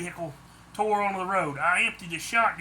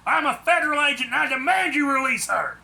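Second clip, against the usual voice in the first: commanding; 8 semitones higher, 7 dB louder than usual. A narrator's reading. Agent confronting kidnappers.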